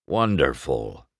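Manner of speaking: low, sour grumble; grumbled. A narrator's reading. Old Doc grumbling at the young race car, sour and low. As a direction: mutter it like a grumpy old car. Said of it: "Doc grumbled".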